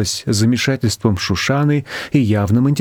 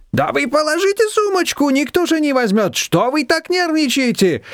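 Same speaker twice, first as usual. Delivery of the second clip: very high-pitched, fussing protest; flustered. A narrator's reading. Shushana trying to take the guest's handbag, flustered. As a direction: fuss at her in a high, agitated voice. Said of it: "всполошилась Шушана".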